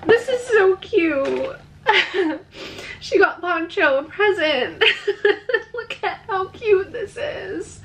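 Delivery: high voice, giggling through happy tears; tearful laughter. Real, not acted. Vlogger moved that her dog got a present, tearful laughter. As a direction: laugh while nearly crying. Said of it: "I'm literally gonna cry".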